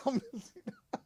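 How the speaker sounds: soft laughing repeat. Real, not acted. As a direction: chuckle the words out quietly. Repeats it laughing, much softer.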